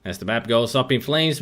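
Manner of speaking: ordinary delivery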